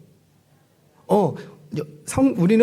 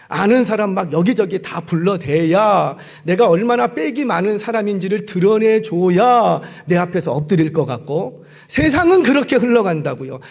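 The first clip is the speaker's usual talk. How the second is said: ordinary talk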